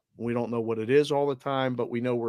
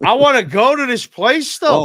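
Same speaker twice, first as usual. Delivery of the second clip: excited; 11 semitones higher, 9 dB louder than usual. Real, not acted. Gushing about a gorgeous town.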